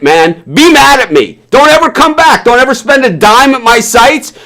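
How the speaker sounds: high-pitched, forceful dismissal; real angry yelling. Real, not acted. Vlogger banishing offended fans from his sites, angry yelling. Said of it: "I'm sorry for screaming".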